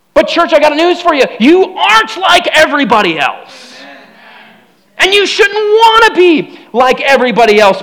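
loud, high preaching call; preaching at full force. Real, not acted. Preacher calling out to the church, loud and high.

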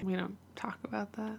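secretive hushed whisper. Pretend. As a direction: whisper it as forbidden. Whispered taboo joke reply.

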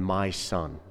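soft, tender my son; a father's gentle greeting. Acted. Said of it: "How much more gentle".

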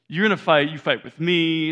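put-on mom charging out to stop a kids' fight, loud; yelling a challenge at the neighborhood boys; fierce mom. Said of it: "that was not the side of her I saw".

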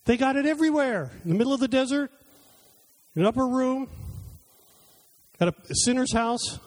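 rising, emphatic preaching voice that slows at the end; sermon delivery at full force; preaching build. Acted. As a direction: build the list up to a pointed stop. Preacher builds toward a climax.

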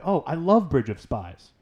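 teasing, drawn-out fake praise; mock enthusiasm. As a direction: gush it slowly to bait a friend. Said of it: "Is that sarcasm?".

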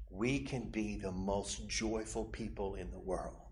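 ordinary delivery

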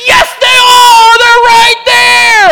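hammed-up screech, very high and drawn out; yelling argument. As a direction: scream back as the frantic wife, every word stretched. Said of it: "we start yelling at each other".

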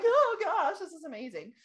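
jumps high and loud then sinks into laugh-crying; amazed gushing. Retold or imitated. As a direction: gasp it out, laughing and teary. Retelling being wowed.

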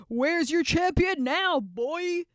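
loud giant's taunt. Retold or imitated. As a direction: jeer at them like a giant bully. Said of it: "Goliath would go out and taunt the armies".

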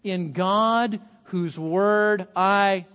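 preacher lingering over the psalm's refrain; slowly drawn-out proclamation. Acted.

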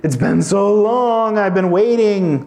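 mock gushing at long last; Adam seeing Eve, hammed-up slow gushing relief; relieved Adam. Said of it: "Does he go".

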